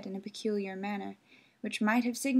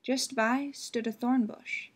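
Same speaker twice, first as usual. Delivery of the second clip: ordinary delivery, nothing marked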